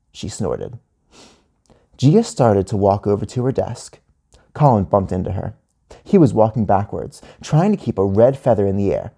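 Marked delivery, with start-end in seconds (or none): none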